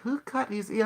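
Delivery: high, indignant question; asking the AI who hurt his cat, pitched high; mock outrage. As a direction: demand to know who did it. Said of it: "I do start asking A I".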